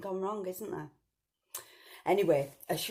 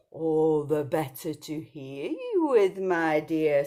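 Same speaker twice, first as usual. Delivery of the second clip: slow, louder menacing wolf; sly wolf; 6 dB louder, slower than usual. Acted. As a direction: draw it out, hungry. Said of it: "said the big bad wolf".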